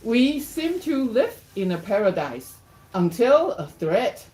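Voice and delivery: lifted pitch, stretched rhyming words; story read-aloud. Acted. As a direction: read the verse out with a deliberate lilt. Reads picture book aloud.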